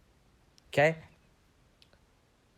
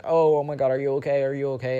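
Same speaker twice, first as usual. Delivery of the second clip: louder, higher fussing over a friend; imitated alarmed concern; 4 semitones higher, 7 dB louder than usual. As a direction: mimic a worried classmate rushing to check. Imitates classmate alarmed by the tears.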